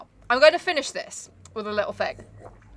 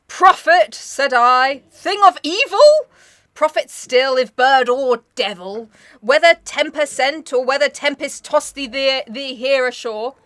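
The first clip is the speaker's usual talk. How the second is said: louder, declaiming recitation, slow in places; dramatic poem reading; 2 semitones higher, 5 dB louder, slower than usual. Acted. Reading The Raven aloud to her co-host, in a declaiming voice. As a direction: give the verse full theatrical weight.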